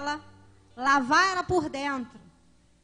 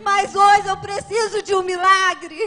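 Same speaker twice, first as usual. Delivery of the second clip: fervent crying prayer; 2 semitones higher, 8 dB louder than usual. Real, not acted. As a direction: cry out to God for a miracle. Clamor por milagre ao lado da mãe. (clamor alto e choroso)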